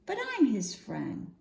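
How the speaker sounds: ordinary delivery, nothing marked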